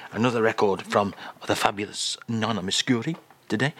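normal talking voice

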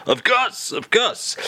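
hammed-up French accent; lightly overdone French charm; mock French greeting. Host greets Charles character.

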